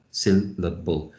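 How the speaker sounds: Syllable said slowly, each beat apart. Shown as a demonstration. Teacher showing the beats in a word, slow three beats. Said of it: "a different note for each".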